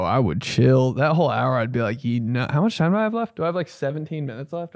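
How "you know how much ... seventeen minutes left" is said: fast quote. Acted. Acted quote during hour.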